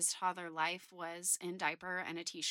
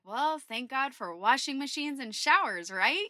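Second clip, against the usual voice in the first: upbeat quip, higher and louder; joking to lighten the mood; 5 semitones higher, 6 dB louder than usual. Retold or imitated. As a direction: toss off a light joke to ease tension. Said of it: "trying to lighten the mood".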